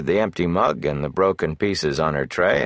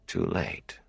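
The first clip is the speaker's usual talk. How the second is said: much softer, distant echo of "Too late." with TOO falling; echoing thought; 10 dB quieter than usual. A narrator's reading. Rand's thought echoing back to him, a faint distant murmur. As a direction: let "Too late." drift off like a faraway echo in his head.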